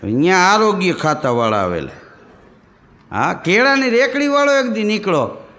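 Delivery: normal talking voice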